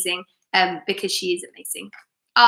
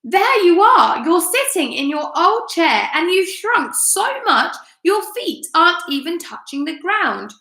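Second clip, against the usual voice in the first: shouted; 7 semitones higher than usual. Acted. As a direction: jeer at his wife like a nasty old man.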